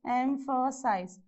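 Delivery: "emphasize" slowly split into three beats; syllable by syllable. Shown as a demonstration. Teacher counting syllables for students, "emphasize" slowly broken up. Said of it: "So how many syllable you have?".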